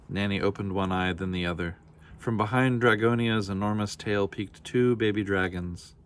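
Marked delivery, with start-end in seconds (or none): none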